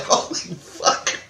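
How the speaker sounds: slow whispered high exclamation through laughter; breathless amazed laugh; stunned laugh. Real, not acted. Narrator hears the past-life claim, whispering and laughing.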